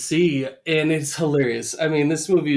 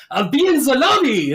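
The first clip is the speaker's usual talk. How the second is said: high, loud mock eagerness; excited Homer; 11 semitones higher, 8 dB louder than usual. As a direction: leap up as an over-eager Homer. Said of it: "like, jumps up".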